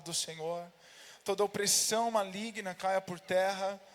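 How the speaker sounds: loud, full-voiced preacher's prayer; fervent prayer. Real, not acted. Pastor leading the church in prayer before the message, fervent and loud. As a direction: cry out the prayer with rising force, easing at the close.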